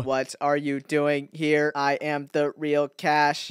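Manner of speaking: slow, low, soft impostor voice; low, measured skeleton in disguise; bad impersonation. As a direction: pose as someone else in a stilted monotone. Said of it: "such a bad impersonation".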